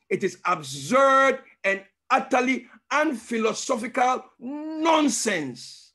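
shouted, pitch rising high on nonsense; scornful shout. Retold or imitated. Preacher voicing Gentiles mocking the gospel, scornful shout. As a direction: fling it out as their scorn.

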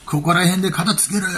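mock thug. Thug demanding a quick settlement, mock tough. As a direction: voice a pushy Kansai heavy, half acted. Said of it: "オラオラ系のが、".

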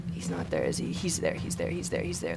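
hushed panicked whisper, repeating fast; frightened whisper. Real, not acted. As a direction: whisper so the monster can't hear. Hiding from monster in game.

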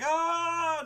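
high, loud mock yell; crowd yell. Rioting crowd defying the ruler, high yell.

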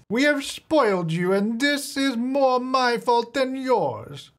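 hammed-up old lady on her deathbed; old grandma voice. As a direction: play the frail grandmother's last words. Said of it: "I don't know why I'm talking like this now".

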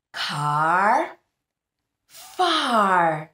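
Car and far said loud and apart; rhyme stressed. Shown as a demonstration. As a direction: punch "Car" then "far". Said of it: "two more rhyming words".

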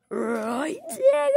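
drawn-out "Right", then "Tigger" leaping high and loud; silly exaggerated question. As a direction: swoop way up on "Tigger".

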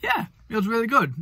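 talking through a mouthful of food; mouth full. Real, not acted. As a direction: speak round a bite of food. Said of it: "I don't wanna eat with my mouth full".

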